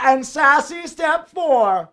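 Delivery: high, very slow sassy call; sassy announcer. Pretend. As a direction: draw it out like a diva. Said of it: "The sassy way".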